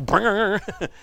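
imitated bouncing rubber record noise; a playful spoken boing. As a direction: make the sound of a record that won't break. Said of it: "They go".

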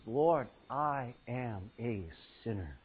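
low, soft, slow and small; humble confession. Acted. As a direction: confess it quietly, ashamed.

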